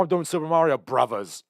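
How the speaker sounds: Bob Hoskins. Retold or imitated. British actor telling his kids about the role, imitated British accent. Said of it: "I'm not gonna do the accent".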